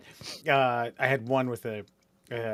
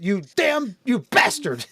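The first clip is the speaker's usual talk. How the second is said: loud, raised mock cursing; hammed-up outrage; 8 semitones higher, 6 dB louder, slower than usual. Mocking officer to the workplace, hammed-up outrage. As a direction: curse them like a melodrama victim.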